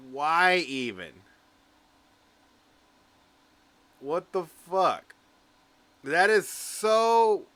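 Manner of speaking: stunned and exasperated; baffled disbelief. Real, not acted. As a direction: react in real bewilderment, stretching the key words. Baffled reaction to the tweet.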